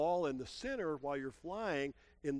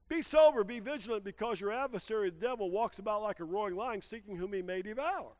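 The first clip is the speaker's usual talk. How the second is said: scripture reading; 5 semitones higher, 5 dB louder than usual. Acted.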